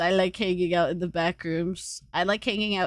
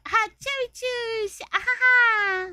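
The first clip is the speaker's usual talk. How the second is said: very high sing-out hello; squealed greeting; 13 semitones higher, 4 dB louder than usual. Real, not acted. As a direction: squeal hello in a tiny high voice, ending loud. Greeting a viewer who arrives.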